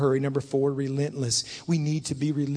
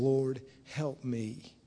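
low, soft and very slow; humble plea; 4 semitones lower, 6 dB quieter, much slower than usual. Acted. Woman worshipping before Jesus, humble plea. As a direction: plead quietly, each word drawn out.

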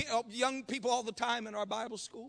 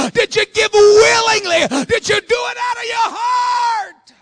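loud, raised, driving pulpit delivery; full-force preaching; 7 semitones higher, 16 dB louder than usual. Acted. Preacher urging the congregation to give from the heart, full-force. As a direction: preach it hard, building to a long final cry.

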